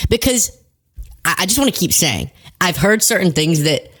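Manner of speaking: ordinary talk, nothing marked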